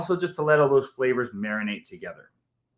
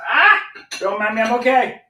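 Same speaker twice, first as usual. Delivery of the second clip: startled; 11 semitones higher, 6 dB louder than usual. Real, not acted. Something just fell mid-stream.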